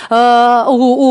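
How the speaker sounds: ordinary talk, nothing marked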